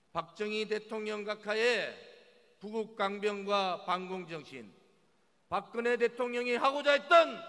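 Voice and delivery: loud, high, emphatic campaign oratory; roaring rallying speech. Acted. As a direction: declare it to the crowd with fire. Rally speech at full force. (힘찬 유세 연설)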